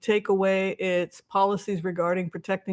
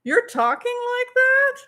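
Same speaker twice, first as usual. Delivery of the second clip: high-pitched mock shock on "you're talking like that"; shocked disbelief; 14 semitones higher, 3 dB louder than usual. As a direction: act appalled, voice leaping up. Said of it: "I'm like".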